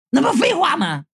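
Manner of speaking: high-pitched, mildly indignant retort; scolding. A narrator's reading. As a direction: auntie telling someone off. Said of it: "大妈们就搁那骂".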